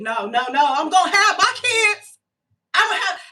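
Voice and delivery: half-crying protest, hammed up; mock crying plea. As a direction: beg like a child refusing no. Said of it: "retelling pleading with the spirit".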